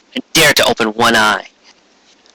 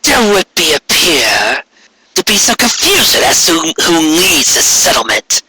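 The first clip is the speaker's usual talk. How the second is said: regal demon queen; 4 semitones higher than usual. Acted. Returned queen demanding the village present itself, imperious. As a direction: command the cowering ponies like a returned tyrant. Corona speaking.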